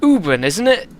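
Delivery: put-on proud steward in a thick West Country accent; West Country Eirik. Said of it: "I think West Country for this guy".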